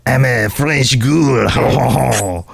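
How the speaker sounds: very slow, put-on French ghoul drawl. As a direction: ham up a French ghoul accent. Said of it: "I wanna do a French ghoul voice".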